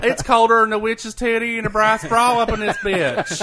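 loud, high country twang through laughter; laughing, hammed-up Southern saying; country accent. Shown as a demonstration. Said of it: "just have to have the country accent".

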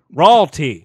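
loud, drawn-out proclamation; grand proclamation. Pretend. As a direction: announce it like a herald. Joking about royalty.

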